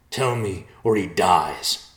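loud, slow menace; threatening. A narrator's reading. Messenger threatens, grabbing Nicholas.

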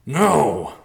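loud, high yelled protest; yelling. A narrator's reading. Riverpaw protests his father's plan, yelling. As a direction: yell it out in refusal. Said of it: "Riverpaw yelled".

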